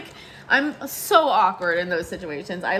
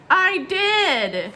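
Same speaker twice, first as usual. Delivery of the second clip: much higher, louder re-enacted reply; high-pitched admission; 11 semitones higher, 5 dB louder than usual. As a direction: sing it out high and drawn. Acted sheepish reply to surgeon.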